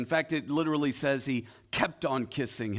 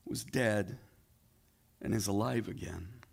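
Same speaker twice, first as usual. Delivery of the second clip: softer, lower, gentle reassurance; father pleading with his older son, tender; 7 semitones lower, 5 dB quieter than usual. Acted. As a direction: coax him like a loving father.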